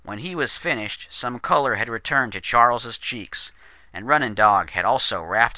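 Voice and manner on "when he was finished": plain reading voice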